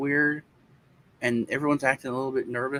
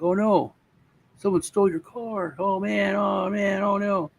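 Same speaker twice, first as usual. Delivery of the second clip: mock dismay; 7 semitones higher than usual. Friends fake alarm over stolen car.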